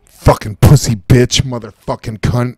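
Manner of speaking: loud slow furious swearing; raging curse at the enemy; angry cursing. Real, not acted. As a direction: spit the insults out in rage. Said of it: "I'm just screaming".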